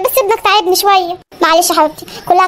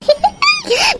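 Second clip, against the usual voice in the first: evil cackle; 13 semitones higher than usual. Acted. Mother-in-law gloats over her trick.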